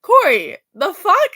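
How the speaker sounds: loud, rising mock outrage at Corey; indignant outburst. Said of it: "and I was like".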